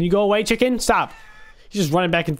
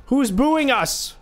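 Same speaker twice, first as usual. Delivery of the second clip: higher and slow, stretching every word; slow mock offense; 7 semitones higher, much slower than usual. Real, not acted. Gamer asks the booing crowd, slow and higher. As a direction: drag it out like you're wounded. Repeats, drawn out.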